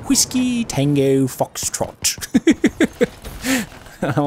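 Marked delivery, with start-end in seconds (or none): 0.1-2.2 slow, drawn-out imitation English accent. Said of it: "The English accent".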